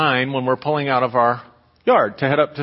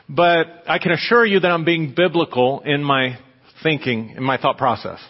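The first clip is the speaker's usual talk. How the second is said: ordinary talk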